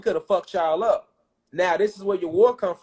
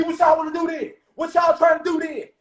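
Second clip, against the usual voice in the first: shouted trash talk; taunting challenge; 8 semitones higher than usual. Retold or imitated. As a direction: goad them into a fight. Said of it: "You know, he talking shit".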